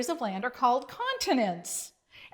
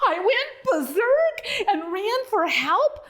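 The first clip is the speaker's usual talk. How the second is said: higher, loud and rushing; panicked; 9 semitones higher, 4 dB louder than usual. A narrator's reading. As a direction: blurt it out in panic. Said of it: "in a panicked voice".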